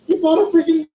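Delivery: can't believe your eyes; stunned at a friend's surprise car; astonished. Retold or imitated. Friend shows up in DeLorean.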